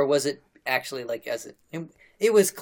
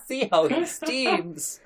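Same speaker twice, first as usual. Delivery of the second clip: laughing; 10 semitones higher, much slower than usual. Real, not acted.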